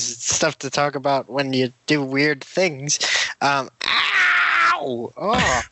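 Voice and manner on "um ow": sharp yelp of pain; sudden pained ow. Real, not acted. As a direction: yelp as it snags. Whisker hooks shirt.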